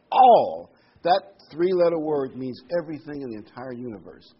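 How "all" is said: All punched out loud on its own; stressed All. Shown as a demonstration. Preacher repeating All for the congregation, loud and emphatic. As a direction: land hard on All. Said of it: "Think about that".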